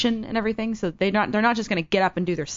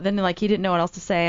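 normal talking voice

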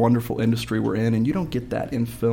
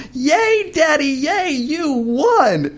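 put-on very high, loud child's cheer; imitated little daughter; 5 dB louder, much slower than usual. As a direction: squeal it like a little girl cheering dad. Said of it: "my daughter stands up and goes".

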